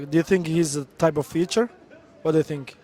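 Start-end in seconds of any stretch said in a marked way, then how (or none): none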